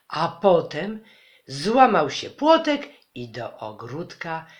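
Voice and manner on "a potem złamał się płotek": "A potem" loud and higher, rising; loud, high "A potem" launching the action; sudden loud burst. A narrator's reading. Suspense build before the beast.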